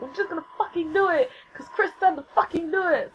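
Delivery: high-pitched retold pep talk; determined, acted-out self-talk; fired-up resolve. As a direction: psych yourself up in a high voice. Retells her resolve to start.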